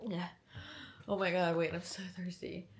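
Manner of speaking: normal talking voice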